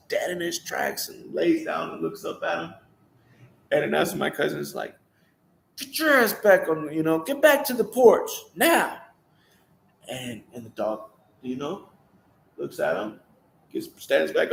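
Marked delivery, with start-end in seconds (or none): 5.8-9.0 stern order. Retold or imitated. Cousin ordering dog to porch.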